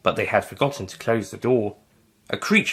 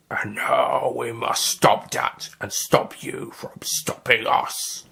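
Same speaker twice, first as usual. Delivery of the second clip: slow menacing alien whisper; whispering creature; 3 dB quieter, slower than usual. Acted. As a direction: breathe the threat slowly. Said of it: "the squid Nestine said".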